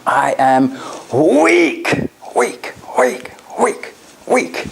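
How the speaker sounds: a strong voice turning the words over; strong tonality. Shown as a demonstration. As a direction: keep the conviction in every weak. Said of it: "Strong tonality".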